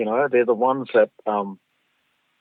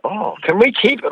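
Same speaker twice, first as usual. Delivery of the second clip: put-on eager kids; 8 semitones higher, 4 dB louder than usual. Kids asking to keep the story.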